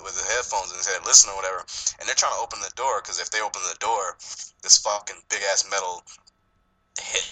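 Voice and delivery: usual speaking voice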